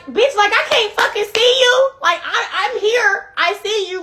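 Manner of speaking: high-pitched disbelieving exclamation; incredulous outburst. Retold or imitated. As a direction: replay the moment you catch him sneaking off. Retold shock at seeing him sneak.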